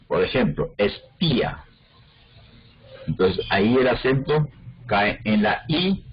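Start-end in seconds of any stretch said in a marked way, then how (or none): 0.8-1.6 espía with stressed í, separate from a; hiatus. Shown as a demonstration. Teacher giving a hiatus example, espía as es-PÍ-a. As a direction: say espía as es-PÍ-a, two vowels apart. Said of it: "sino que se llama hiato".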